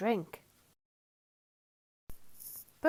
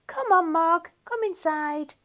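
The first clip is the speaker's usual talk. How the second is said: louder, higher coaxing call; 8 semitones higher, 6 dB louder than usual. A narrator's reading. Nicky calling Mog in out of the rain, coaxing. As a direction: coax the cat in.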